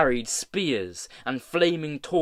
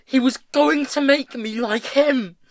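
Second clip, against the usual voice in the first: high, loud, furious wail; distraught high-pitched yell; anguished scream; 10 semitones higher, 8 dB louder than usual. A narrator's reading. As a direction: scream it at him in despair. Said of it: "screamed Hester".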